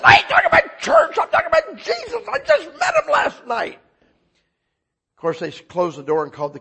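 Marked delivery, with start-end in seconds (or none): 0.0-3.8 excited shouting. Retold or imitated. Salesman shouting about God at doors. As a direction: yell it at the doorstep. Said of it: "I said".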